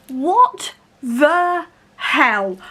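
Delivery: very slow, each word dragged out; stunned disbelief. Real, not acted. Reader reacting to a plot twist, stunned. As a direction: draw it out in real shock.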